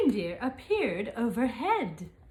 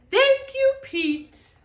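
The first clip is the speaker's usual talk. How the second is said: grateful exclamation; 13 semitones higher, 7 dB louder, slower than usual. Acted. Santa thanks Pete, high loud.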